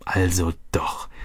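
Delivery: hushed, breathless whisper. A narrator's reading. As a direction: breathe it out in disbelief. Realising quietly.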